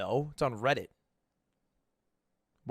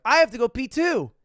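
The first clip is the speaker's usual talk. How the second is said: put-on whiny kids; 9 semitones higher, 7 dB louder than usual. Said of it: "They're like".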